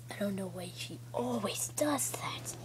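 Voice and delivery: soft whispered aside, rising on does. Pretend. Toy whispering about a friend's habit, in a whisper. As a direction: lean in and whisper the gossip.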